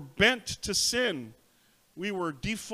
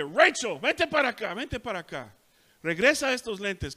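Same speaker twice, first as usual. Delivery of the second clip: put-on calling the child; 5 semitones higher, 5 dB louder than usual. Mother calling daughter at Burger King.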